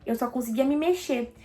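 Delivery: ordinary talk, nothing marked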